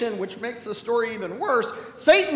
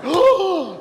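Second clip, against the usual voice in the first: shocked gasp; 9 semitones higher, 7 dB louder than usual. Retold or imitated. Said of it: "was like".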